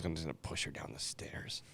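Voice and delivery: hushed voiceless whisper. Real, not acted. Joking to friends about pushing her, hushed whisper. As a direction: whisper it like a dark secret. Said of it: "joking dark confession".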